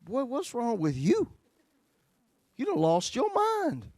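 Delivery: high, slow self-scolding. Retold or imitated. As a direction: tell yourself off in disbelief, drawn out. Scolding himself alone.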